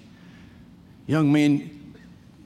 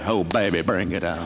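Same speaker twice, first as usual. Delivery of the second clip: 1 relishing; 8 semitones lower, 3 dB quieter than usual. Real, not acted. Relishing the fight.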